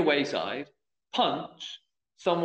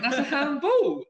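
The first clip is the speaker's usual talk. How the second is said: higher, slower, laughing through it; telling the manager, laughing in disbelief; amused disbelief; 12 semitones higher, 4 dB louder, slower than usual. Retold or imitated. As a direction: let the laugh break in as you state the obvious. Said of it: "I went".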